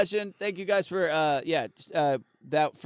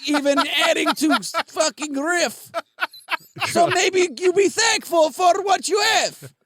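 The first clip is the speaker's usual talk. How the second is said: mock-offended Russian accent, louder; scolding in a hammed-up Russian accent; put-on Russian accent, raised and indignant; 4 semitones higher, 5 dB louder than usual. Russian character gets indignant.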